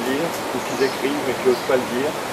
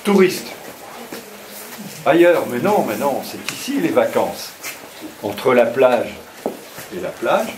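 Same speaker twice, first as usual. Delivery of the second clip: poem recitation. Acted. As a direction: declaim the lines to the group. Recites his poem on tour.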